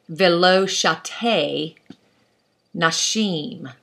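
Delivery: "Velo shate nashim" read very slowly, syllable by syllable, in Hebrew; slow careful Hebrew reading; Hebrew read aloud. Shown as a demonstration. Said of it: "You see that phrase there?".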